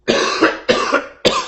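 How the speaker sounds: harsh real coughs; coughing fit. Real, not acted. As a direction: cough hard, clearing the throat. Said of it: "excuse me".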